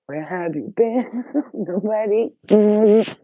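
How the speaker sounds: high slow cooing voice. Real, not acted. Asking her dog twin where she's been, cooing. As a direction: coo to the pet sweetly.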